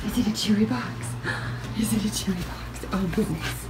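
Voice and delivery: hushed teasing whisper; whispering to the dogs over the box. Real, not acted. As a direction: whisper it like a thrilling secret. Teasing excited dogs about box.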